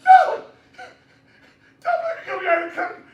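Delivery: high, panicked screaming with laughter breaking through; top of lungs yell. Retold or imitated. Shouting at the whole room not to be sent behind the curtain. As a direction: scream like your life depends on it. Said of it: "At the top of my lungs, I yell".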